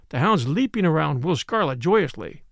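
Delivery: ordinary delivery, nothing marked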